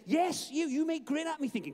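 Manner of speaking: high, loud, pointed call; single out one doubter in the crowd; voicing God. Preacher as God picking out a doubting listener, high and loud. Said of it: "I can almost hear him saying".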